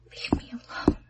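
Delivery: unvoiced scared whisper. A narrator's reading. Connie pleading with Arnold, a frightened whisper. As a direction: barely breathe the words. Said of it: "Connie whispered".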